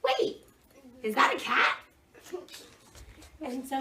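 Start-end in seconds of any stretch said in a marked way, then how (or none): 0.0-1.8 surprised. Retold or imitated. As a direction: blurt it out in surprise. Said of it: "he stopped and said".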